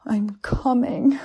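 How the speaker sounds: high, loud, slow moan. A narrator's reading. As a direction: moan it out at the peak. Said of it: "I moaned".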